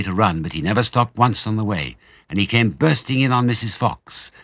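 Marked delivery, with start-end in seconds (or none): none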